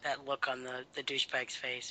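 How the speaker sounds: normal talking voice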